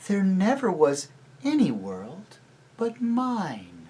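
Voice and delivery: high, slow, commanding witch. A narrator's reading. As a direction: insist like a spellbinding witch. Witch insists.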